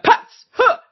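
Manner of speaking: sudden high, loud "boo" to startle; jump scare. Retold or imitated. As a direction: leap out and yell her name. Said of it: "She's like".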